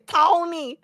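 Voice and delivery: mock Tony call. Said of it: "the only thing I can say is".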